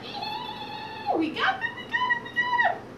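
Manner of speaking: very high, excited pig squeal; gleeful squeal. Acted. Pigs sure they trapped the wolf, gleeful squeal.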